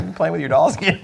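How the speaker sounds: dad teasing his son about dolls, mock teasing voice. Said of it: "I'm like".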